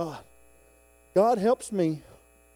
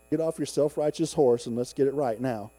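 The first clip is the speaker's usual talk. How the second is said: ordinary talk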